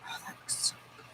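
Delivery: unvoiced, hushed craving whisper. Real, not acted. Scrolling past tasty food, a hushed whisper. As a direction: whisper it like you're drooling.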